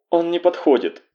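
high, tearful voice; sobbing. A narrator's reading. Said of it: "вдруг впервые всхлипнула". (высокий голос, со всхлипом)

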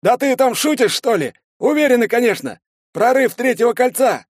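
high, loud indignant radio shouting; возмущённый крик. A narrator's reading. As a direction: argue with the base, outraged. Злой спор с базой по рации. (возмущённо кричать в рацию)

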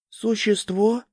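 put-on small, high, timid young fawn; a child hiding behind its father; little fawn voice. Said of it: "вторил ему маленький оленёнокет".

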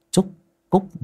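ordinary delivery

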